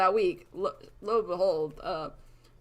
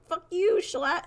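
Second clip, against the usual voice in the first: mock angry outburst; 10 semitones higher, 5 dB louder, slower than usual. Player defies manager before shooting him.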